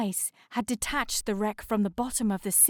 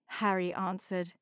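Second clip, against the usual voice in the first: usual speaking voice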